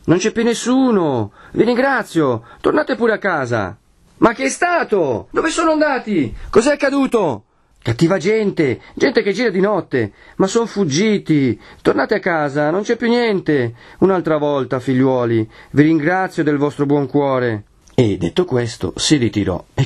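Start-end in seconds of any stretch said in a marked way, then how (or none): 0.0-3.8 high-pitched, drawn-out call down; priest dismissing crowd. Acted. 4.2-7.5 high-pitched insistent questions; clamouring crowd. Acted. Crowd shouts back at priest. 7.8-17.6 high, slow, drawn-out reassurances; priest placating crowd. Acted.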